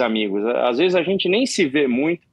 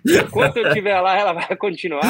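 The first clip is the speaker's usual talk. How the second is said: laughing; 6 semitones higher than usual. Real, not acted. Laughing about the dance.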